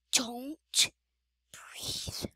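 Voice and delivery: unvoiced hushed whisper. Real, not acted. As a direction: breathe it out silently. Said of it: "try not to make a sound".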